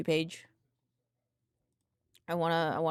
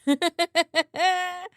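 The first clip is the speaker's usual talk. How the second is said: high, rapid staccato giggle; retold giggle; 9 semitones higher, 5 dB louder than usual. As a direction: act out a cackling laugh. I'm like.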